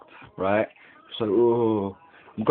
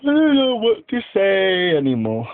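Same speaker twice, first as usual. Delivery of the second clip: put-on loud voice; 10 semitones higher, 4 dB louder than usual. Sudden put-on exclamation.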